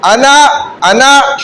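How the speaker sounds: अना, अना stretched out slowly, each vowel held long; slow drawn-out recitation. Shown as a demonstration. Teacher showing students how to recite, slow and drawn out. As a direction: draw out अना, अना slowly like a drill. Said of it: "उंगली दिखाकर इस तरह".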